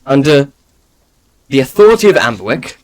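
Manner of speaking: stern official reading charges; formal proclamation. Acted. Guard reads the charges.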